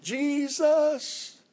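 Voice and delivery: high, far-off anguished cries of the name; screaming Jesus. Retold or imitated. Dying woman in a nursing home crying out to Jesus, high anguished screaming. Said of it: "she was screaming as loud as she could".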